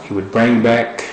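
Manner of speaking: normal talking voice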